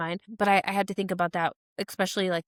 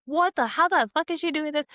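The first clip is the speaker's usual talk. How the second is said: higher, stunned, how-is-she-doing-this; disbelief; 8 semitones higher than usual. Retold or imitated. Scrolling a vacation friend's rich posts, stunned disbelief. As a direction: gape at the impossible. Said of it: "I'm like".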